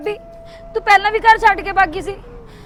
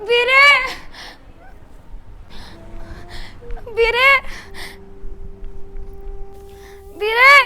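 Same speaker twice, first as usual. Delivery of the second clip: anxious, tearful calls for her brother; tearful worry; 4 dB quieter than usual. Acted. Sister calling her brother, worried and tearful. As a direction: fear rising for her son.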